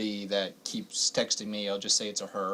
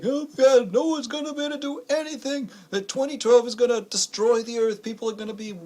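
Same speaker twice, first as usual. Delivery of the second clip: imitating her, higher frantic voice; mock doomsday panic; 9 semitones higher than usual. Host imitating a caller panicking about 2012, higher mock panic. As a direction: rattle off the doom a little high. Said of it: "It's like".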